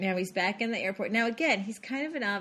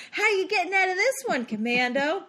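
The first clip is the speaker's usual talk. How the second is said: mock taunt; 9 semitones higher, 4 dB louder than usual. Mock taunt to the action hero.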